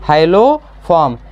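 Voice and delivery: slow, syllables held long; drawn-out key term. Real, not acted. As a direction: spell out the answer slowly for students. Teacher answers own question with key term.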